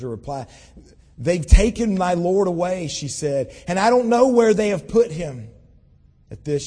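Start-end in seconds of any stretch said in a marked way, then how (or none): 1.2-2.9 louder, urgent and grieving; Mary pleading, louder; distressed plea. Acted. Mary's distressed reply at tomb. 3.7-5.5 louder, urgent and grieving; Mary pleading, louder; distressed plea. Acted. Mary's distressed reply at tomb.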